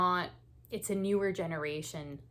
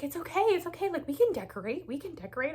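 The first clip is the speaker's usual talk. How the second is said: comforting; 11 semitones higher, 5 dB louder, faster than usual. Retold or imitated. Husband comforts crying wife.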